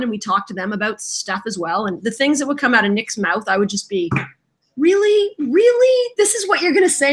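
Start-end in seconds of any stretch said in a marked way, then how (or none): 4.8-7.1 high-pitched incredulous questioning; mock disbelief. Co-host reacting to what came out of friend's mouth, mock incredulous.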